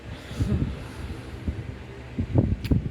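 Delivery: long unvoiced sigh, close to tears; a drawn-out sigh before admitting tears; heavy sigh. Real, not acted. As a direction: breathe out hard, holding back tears. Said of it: "I'm trying not to cry".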